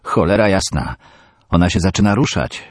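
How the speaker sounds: shouting in alarm to his friend; panicked yell. A narrator's reading. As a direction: yell it in panic as she stirs. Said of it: "wrzasnął Jasiek".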